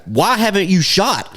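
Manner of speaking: high, slow, exasperated retold text; all-caps demand. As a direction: stretch it out like a mock all-caps message. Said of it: "all caps".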